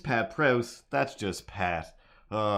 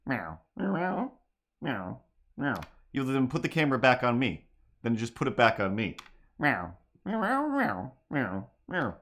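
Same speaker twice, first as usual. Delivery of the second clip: string of mock meows; cat meows; 2 semitones higher than usual. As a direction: meow like a house cat. Meowing like a cat.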